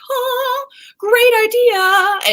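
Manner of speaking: high gasped oh, then slow drawn-out words; delighted realization. Acted. Writer struck by a dream idea, delighted realization. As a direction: sing out a brilliant idea, high and stretched. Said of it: "where I was like".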